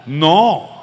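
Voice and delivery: a sudden loud, blunt "No"; firm loud no. Acted. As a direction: cut it off with a loud flat refusal. Pastor firmly rejects pushing away.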